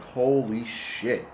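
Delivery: drawn-out, hushed holy shit; slow stunned gasp. Retold or imitated. Recalling his own panic attack, slow and stunned. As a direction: let it sink in slowly, stunned. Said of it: "I was like".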